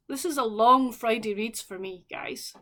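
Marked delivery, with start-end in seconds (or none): none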